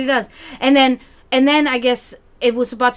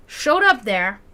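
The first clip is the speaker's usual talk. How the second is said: usual speaking voice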